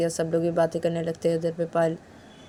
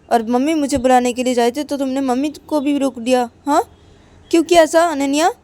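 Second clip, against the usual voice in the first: higher and louder, a sulky angry scolding; annoyed complaint; 5 semitones higher, 4 dB louder than usual. Acted. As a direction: complain hotly to her friend. Payal scolds Ananya for not waking her. (ऊँची आवाज़ में नाराज़ शिकायत)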